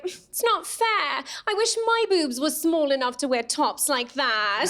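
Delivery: imitated English friend, drawn out; slow, complaining English accent. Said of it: "glad you guys laughed at that accent".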